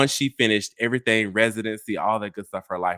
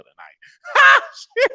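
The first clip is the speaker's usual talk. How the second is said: slow, louder, half-laughing panic; laughing alarm; 11 dB louder than usual. Real, not acted. Vlogger caught saying mom's age, laughing loud. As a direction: laugh at her own slip. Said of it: "oh, I done said her age".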